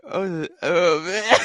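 wailing with laughter; crying laughing. Real, not acted. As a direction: laugh till it hurts.

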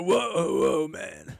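bad [name] impression. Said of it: "I can't do [name]".

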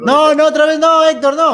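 high, loud playful protest; pleading with Héctor not to start again, loud and high; mock pleading. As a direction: beg him to stop, hammed up.

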